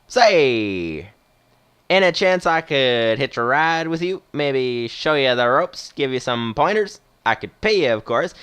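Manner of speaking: put-on Western movie old-timer pitching an offer; Western accent. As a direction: play a retired captain with a Western twist. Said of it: "doing a sort of Western accent".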